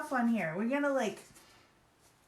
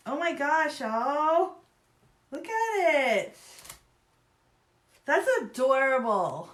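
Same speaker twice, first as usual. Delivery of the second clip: higher and louder, slow gushing; gushing delight; 5 semitones higher, 7 dB louder, slower than usual. Real, not acted. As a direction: gush over how adorable it is. Gushing over trailer.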